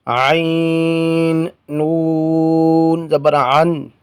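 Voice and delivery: drawn-out, chanted letter-by-letter spelling; slow spelling recitation. Shown as a demonstration. Teacher slowly chanting the spelling of each word for students. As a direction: stretch each letter name like a Quran teacher.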